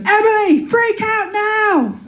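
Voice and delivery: high, slow, frantic voice; panicky anxiety voice. Acted. Her anxiety yelling at her to panic, high and frantic. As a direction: shriek it like your own anxiety. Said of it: "my anxiety goes".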